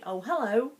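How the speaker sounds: high, loud, cheery hello; an upbeat rising hello; bright greeting. Shown as a demonstration. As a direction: greet them with delight. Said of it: "the tone actually infers something completely different".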